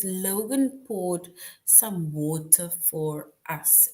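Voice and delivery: very slow, every word laid out; slow read-aloud. Shown as a demonstration. Teacher reading the sentence slowly to the class. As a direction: read it slowly so students can follow. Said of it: "It says".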